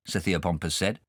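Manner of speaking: ordinary delivery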